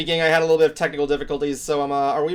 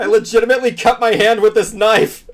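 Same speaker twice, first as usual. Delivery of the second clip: mock alarm; 6 semitones higher, 6 dB louder than usual. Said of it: "Wow, you're horrible".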